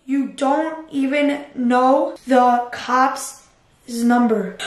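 slow, drawn-out taunting message; type-along slow mockery. Real, not acted. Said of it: "I'm gonna say".

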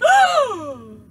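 high, loud mock gasp; big scandalized intake, high and loud; shocked gasp. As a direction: gasp in exaggerated horror. Grandma's shocked reaction acted out.

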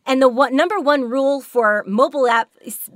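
ordinary talk

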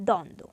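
দ্বন্দ্ব said "dondo" with a weighted opening; extra initial stress. Shown as a demonstration. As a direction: lean on the first d of দ্বন্দ্ব. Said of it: "অতিরিক্ত ঝোঁক পড়বে".

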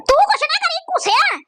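high, drawn-out scolding shout; exaggerated indignant outburst; outraged yell. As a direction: tell him off at a high pitch. Snaps at the man in clip.

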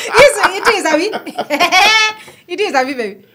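high, loud words through laughter; laughing tease. Real, not acted. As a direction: tease your partner while cracking up. Teasing partner through her laughter.

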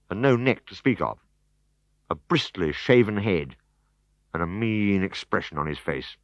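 plain reading voice

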